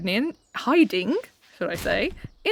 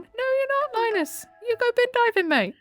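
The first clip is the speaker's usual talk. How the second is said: sugary sweet reassurance; 14 semitones higher than usual. Pretend. Sweetly reassuring Linus in-game.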